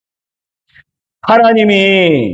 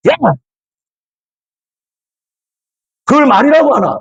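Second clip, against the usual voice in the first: higher, sharp mock scolding in Gyeongsang dialect; angry scolding; 7 semitones higher, faster than usual. Father snapping at his daughter's careless words, mock angry. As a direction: snap at her like a fed-up dad. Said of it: "딱 이렇게 되는 거예요".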